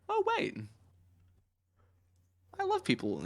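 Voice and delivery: high squeaky gasp of realization; mock Lady Macbeth. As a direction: pitch the oh way up, surprised. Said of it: "she realizes that".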